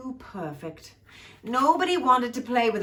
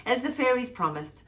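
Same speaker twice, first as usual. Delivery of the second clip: plain reading voice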